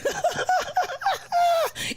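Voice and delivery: high giggling burst; high laugh. Real, not acted. Laughing at chat.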